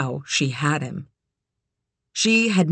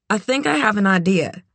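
put-on higher, tentative young woman; 4 semitones higher than usual. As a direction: call to him, a bit nervous. Kit voiced higher.

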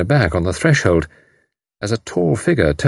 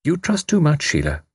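sharp; 8 semitones higher than usual. A narrator's reading. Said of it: "She said sharply".

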